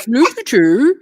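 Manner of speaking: a jokey stab at Norwegian; mock Norwegian accent. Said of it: "we're moving into the Norwegian".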